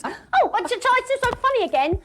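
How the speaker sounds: high, laughing put-on voice; mock laughing friend. Friend giggling in the back of the car, high and laughing. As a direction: squeal through the laughter.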